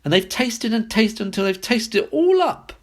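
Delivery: high, near-tearful cub; baby bear squeal. A narrator's reading. Baby bear finds his bowl empty, high squeal. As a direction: squeal it with a wobble. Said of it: "squealed the baby bear".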